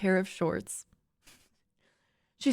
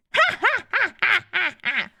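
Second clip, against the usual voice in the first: high, louder teasing cackle; 9 semitones higher, 5 dB louder than usual. Acted. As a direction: cackle at him playfully. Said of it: "Fiona cackled".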